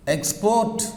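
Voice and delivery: "Export" with the second syllable stressed, ending on a rise; stress on last syllable. Shown as a demonstration. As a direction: lift and weight the end of "Export". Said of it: "on last syllable".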